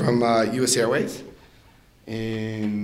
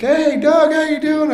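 very high, put-on greeting voice; mock chirpy greeting; 5 dB louder than usual. Others greeting the speaker in passing, mock chirpy. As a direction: pitch it way up, imitating an eager hello. Quoting people greeting him brightly.

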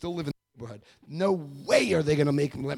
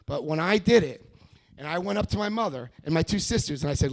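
usual speaking voice

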